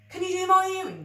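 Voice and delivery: high breathless gasp; a sudden excited gasp. Acted. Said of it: "gasped Ed".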